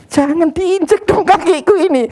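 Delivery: high strained complaint of a hurt man; pained plea. Acted. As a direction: yelp it out like someone whose foot is being stepped on. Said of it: "Maksudnya bilang apa?".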